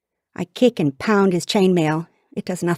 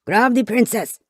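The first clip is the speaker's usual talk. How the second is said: higher, rough-edged attacker's call; shouted order; 5 semitones higher than usual. A narrator's reading. Krongan attacker ordering the princess seized, shouting. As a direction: call out a command in battle. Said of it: "a message shouted in Krongan".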